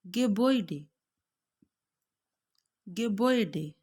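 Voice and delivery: Gebäude said slowly, clearly: गेबोइडे; German pronunciation. Shown as a demonstration. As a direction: drill the German word गेबोइडे. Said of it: "ओए के बाद हमने जो है वो निकालनी है".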